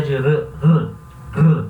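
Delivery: r isolado com uma batida da língua; tapped R. Shown as a demonstration. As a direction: toque a língua uma vez no r. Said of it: "Aí é a língua que vai vibrar". (r simples, batido)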